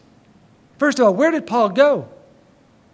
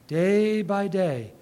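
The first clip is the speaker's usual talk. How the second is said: slow drawn out; 3 semitones lower, 4 dB quieter, much slower than usual. Shown as a demonstration. Slow repeated phrase.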